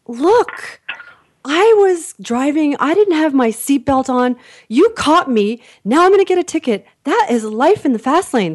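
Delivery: high, punchy mock speech to the officer; emphatic protest. Caught driver reasoning with the policeman, emphatic mock protest. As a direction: argue it grandly, hammed up.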